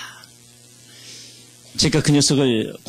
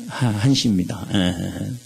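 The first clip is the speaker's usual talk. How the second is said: ordinary talk, nothing marked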